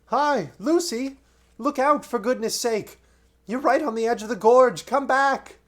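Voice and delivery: high, loud calling out; frantic shouted warning; shouting from afield. Acted. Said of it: "heard the voices of the others shouting".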